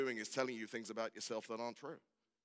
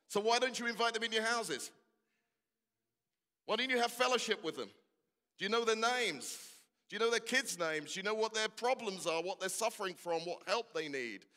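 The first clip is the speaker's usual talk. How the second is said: high, loud, pressing questions; preacher raising his voice to challenge the congregation; forceful preaching; 9 semitones higher, 4 dB louder than usual. Real, not acted. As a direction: hammer each question home from the pulpit.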